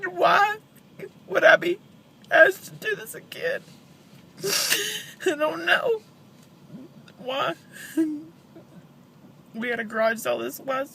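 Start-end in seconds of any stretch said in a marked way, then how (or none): 0.1-7.6 tearful pleading; crying out why; snotty crying. Real, not acted. Grieving mother breaks down.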